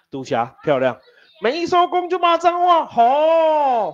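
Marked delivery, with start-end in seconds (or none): 1.4-3.9 much higher, hammed-up squeaky warning; mock high warning. Teacher teasing class about swearing, mock high voice. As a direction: repeat it in a silly high voice.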